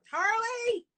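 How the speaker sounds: sharp high shout across the room; yelling for dog. Real, not acted. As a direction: shout the dog's name loud and high.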